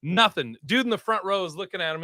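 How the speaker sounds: usual speaking voice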